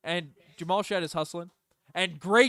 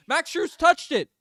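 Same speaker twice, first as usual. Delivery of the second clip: much higher, louder and slowed down; outraged disbelief; 12 semitones higher, 7 dB louder, slower than usual. Real, not acted. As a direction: protest the call in disbelief. Protesting a missed call.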